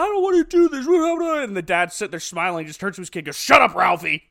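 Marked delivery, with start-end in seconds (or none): 0.0-1.4 put-on high, whiny kid voice; a son whining at his dad, high and whiny. 3.4-4.2 curt, louder shut up; a father dismissing his son, curt and louder; dad's shut up. Retold or imitated. Dad's flat rebuke.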